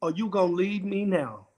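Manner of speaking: low, soft and vulnerable; weak, halting husband. Retold or imitated. Stricken husband asks his wife, low and soft. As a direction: ask it quietly, low in the voice. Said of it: "he began to stammer and develop words".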